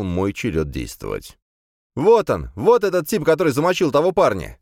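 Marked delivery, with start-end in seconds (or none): 1.9-4.6 high, loud outcry; yelling accusation. A narrator's reading. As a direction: yell it out, pitched way up. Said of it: "завопил я".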